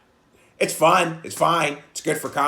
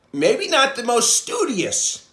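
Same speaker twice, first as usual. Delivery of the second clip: mock dumb jock; 11 semitones higher, 6 dB louder, slower than usual.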